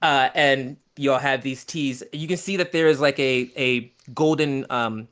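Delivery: usual speaking voice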